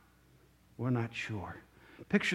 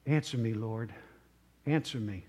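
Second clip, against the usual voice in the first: low, soft plea to God; hushed prayer; 8 semitones lower, 6 dB quieter than usual. Retold or imitated. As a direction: drop down into a quiet, earnest prayer. Retold Elijah's prayer, pleading.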